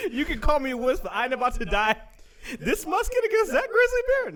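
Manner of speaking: high, loud mock indignation; comic refusal. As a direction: protest in hammed-up fright. Mock refusal to face bear.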